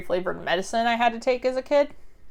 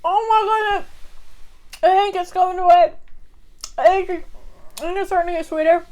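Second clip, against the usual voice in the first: eating sour candy; 9 semitones higher, faster than usual. Real, not acted.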